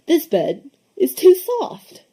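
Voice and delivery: high, slow, drawn-out complaint; fussy complaint. A narrator's reading. Tacky testing the beds.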